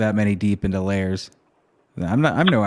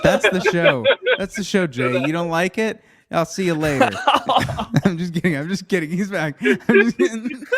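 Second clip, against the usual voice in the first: laughing fake sign-off; 7 semitones higher than usual. Fake ending the show, laughing.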